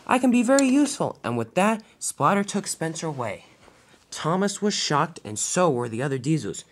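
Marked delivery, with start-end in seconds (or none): 0.1-1.2 high, loud desperate plea; crying out. Acted. Spencer begs not to be scrapped, crying out. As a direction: plead loudly to be spared. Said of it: "cried Spencer".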